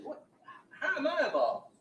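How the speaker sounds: high, loud startled what; high, loud retold disbelief; shocked disbelief. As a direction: sound caught off guard by the claim. Client retold, confused at police.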